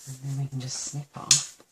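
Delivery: normal talking voice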